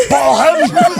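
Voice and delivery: high, loud, laughing command; mock director's order. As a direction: call it out like a gleeful director. Imitating director ordering the attack.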